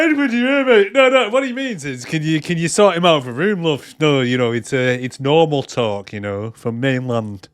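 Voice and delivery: chatty Yorkshire woman sorting out a room; Yorkshire accent. Retold or imitated. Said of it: "I don't know why she's Yorkshire".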